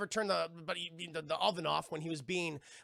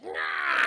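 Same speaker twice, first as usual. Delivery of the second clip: high, loud lunging yell; imitated battle screech; mock attack cry; 12 semitones higher, 8 dB louder than usual. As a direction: shriek it like a stabbing monster. Imitating the chair lunging to stab.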